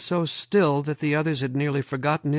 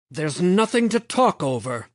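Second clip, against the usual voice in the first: raised, heated retort; hotly; 6 semitones higher, 5 dB louder than usual. A narrator's reading. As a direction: bite back, hot-tempered. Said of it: "Lomax said hotly".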